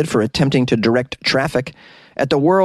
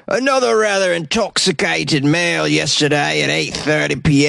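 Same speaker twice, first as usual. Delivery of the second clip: higher-pitched comic Sussex police inspector; attempted Sussex accent; 4 semitones higher than usual. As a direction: quote the inspector in a put-on English accent from Sussex. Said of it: "I don't know if I got that accent correct".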